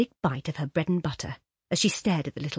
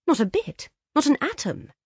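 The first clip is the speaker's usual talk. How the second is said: emphatic; 9 semitones higher, 4 dB louder than usual. Acted. Laura's emphatic denial.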